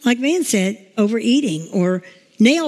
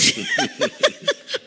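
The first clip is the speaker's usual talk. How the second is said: high tearful laugh; 5 dB quieter than usual. Real, not acted. Teller overcome with emotion.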